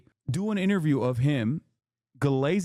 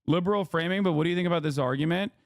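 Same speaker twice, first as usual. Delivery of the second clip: normal talking voice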